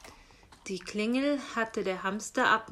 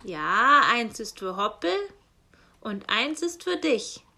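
loud raven voice with harsh caws; croaking raven; 7 dB louder than usual. Acted. Raven postman grumbles to himself, loud and cawing. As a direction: play the grumbling raven squawking Kra.

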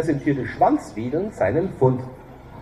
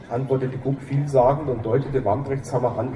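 plain reading voice

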